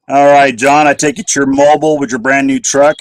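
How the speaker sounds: ordinary delivery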